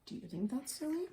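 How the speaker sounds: ordinary delivery, nothing marked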